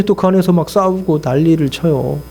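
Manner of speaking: normal talking voice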